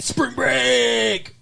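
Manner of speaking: mock spring-break whoop, pitched high; high, drawn-out mock cheer; party cheer. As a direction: holler it like a partygoer.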